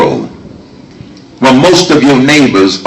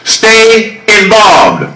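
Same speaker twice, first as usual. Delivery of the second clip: rally oratory; 12 semitones higher than usual. Real, not acted. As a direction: hammer each line home to the crowd. Rally speech at full force.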